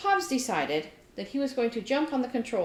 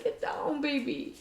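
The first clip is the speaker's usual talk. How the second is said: mock scolding the cat; 8 semitones higher, 5 dB quieter than usual. Telling cat off TV, retold.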